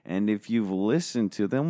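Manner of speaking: ordinary talk, nothing marked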